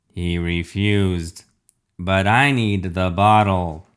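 very slow robot villain; drawn-out, menacing demand; refrigerator villain. Acted.

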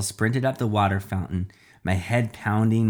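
plain reading voice

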